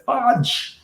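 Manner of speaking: high-pitched mock scream, held back in volume; high, contained mock outburst; retold scream. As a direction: imitate a frustrated yell without real volume. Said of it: "He just screamed that word".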